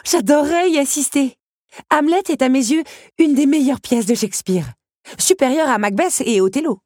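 enthusiastic. A narrator's reading. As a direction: gush about her favourite play. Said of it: "s'enthousiasma la jeune femme". (Belle s'enthousiasme devant le comédien, voix haute et enjouée)

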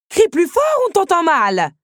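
mock him for yelling; sarcastic rebuke. Mother sarcastically scolds Zip for yelling.

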